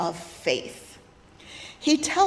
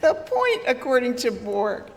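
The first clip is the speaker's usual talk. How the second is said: laughing. Real, not acted. Laughing after the braying.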